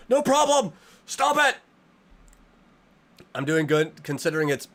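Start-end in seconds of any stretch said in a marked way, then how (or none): 0.1-1.6 high, loud mock outburst; mock shout. Streamer yelling at a donor's name in mock panic.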